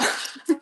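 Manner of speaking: helpless wheezing giggle fit; real laughter, breathless and nearly silent; cry laughing. Real, not acted. Streamer losing it over a tongue twister, cry laughing. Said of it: "I was gonna cry laughing".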